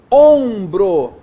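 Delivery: "Ombro" loud, first syllable punched and held; stressed nasal syllable. Shown as a demonstration. Teacher showing a nasal tonic, "Ombro" loud with long first syllable. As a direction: lean hard on the "Om" of "Ombro". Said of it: "sílaba tônica, nasal".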